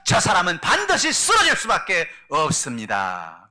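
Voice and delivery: put-on a young shepherd's loud, brave vow; bold declaration. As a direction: declare it boldly to the king. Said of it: "그는 나가서 말합니다".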